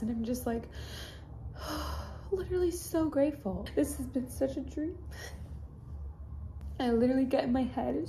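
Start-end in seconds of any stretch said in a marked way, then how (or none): 1.6-5.0 real tearful sigh, then higher voice; overcome, sigh and speak higher through tears; crying. Real, not acted. Vlogger grateful for the meet and greet, crying. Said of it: "I'm a crying mess".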